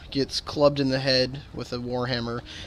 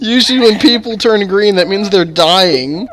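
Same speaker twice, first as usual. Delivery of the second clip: laughing; 8 semitones higher, 12 dB louder than usual. Real, not acted. GM retorting amid laughter.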